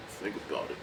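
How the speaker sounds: low, soft, nearly whispered; Rocky hushed. Retold or imitated. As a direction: drop it quietly. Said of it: "Rocky's just like".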